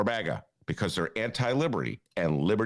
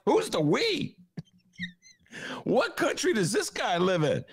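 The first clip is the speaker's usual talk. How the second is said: incredulous; 14 semitones higher than usual. Retold or imitated. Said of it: "And I'm like".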